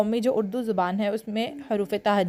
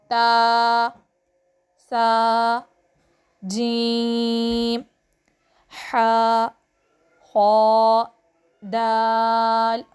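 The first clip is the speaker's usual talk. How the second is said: each letter called out slowly and louder, like a class chant; letter recitation; 4 dB louder, much slower than usual. Shown as a demonstration. Teacher reads the letter board to pupils, slow loud recitation. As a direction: chant the alphabet board for children to repeat.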